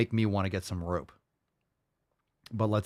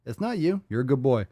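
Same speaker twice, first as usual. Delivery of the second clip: retold soothing; 6 semitones higher than usual. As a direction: retell comforting the cowering dog. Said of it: "he was, like, cowering".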